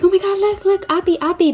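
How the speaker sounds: high-pitched imitation of an excited toddler; excited child. Mom imitating her toddler spotting things from the plane, excited. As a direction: act out a little kid's excited calls. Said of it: "He was very, very excited. He was like".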